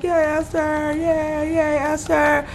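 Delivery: high, sing-out imitation of a cheering crowd; mock cheering. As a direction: cheer along like an adoring crowd, pitched high. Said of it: "everybody claps, they're like".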